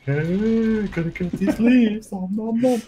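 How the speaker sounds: high, slow cartoon animal voice; munch along as a high-voiced giraffe; the dog voice on a giraffe. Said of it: "now we just use that voice for everything".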